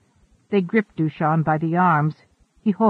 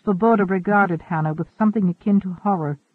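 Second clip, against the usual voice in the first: ordinary delivery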